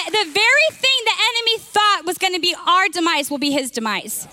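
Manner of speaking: rally the church with conviction; impassioned preaching. Real, not acted. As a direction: preach it up, pitched high and emphatic. Preaches with rising force to church.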